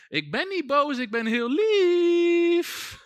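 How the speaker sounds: high, sugary demon voice crying out through a woman; a demon pleading that it is lovely and not angry, high and slow. Retold or imitated. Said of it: "die geest die riep door haar heen".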